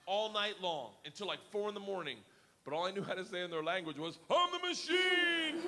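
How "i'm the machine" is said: high, loud, drawn-out holler; drunken-boast yell. Retold or imitated. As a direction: yell your one phrase with pride. His only phrase, yelled all night.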